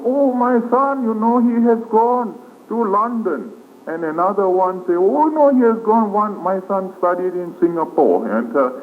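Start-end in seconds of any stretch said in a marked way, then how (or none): 0.0-3.5 high mock boasting voice; pitched-up imitation of market gossip; imitating bragging mother. 4.9-8.3 high mock boasting voice; pitched-up imitation of market gossip; imitating bragging mother. Second mother topping the first.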